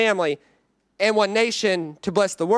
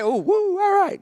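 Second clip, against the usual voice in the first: mock cheer; 9 semitones higher than usual. Cheering for Maroons fans.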